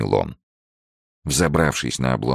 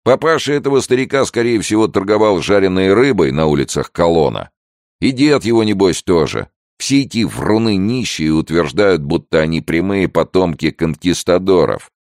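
loud, higher-pitched derision; scoffing gendarme; 7 semitones higher, 5 dB louder than usual. Acted. Gendarme derides old man's claimed ancestry, loud scoffing. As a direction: scoff with voice raised.

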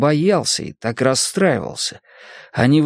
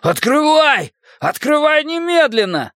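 roaring. Acted. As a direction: bellow the order. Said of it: "проревел Генри".

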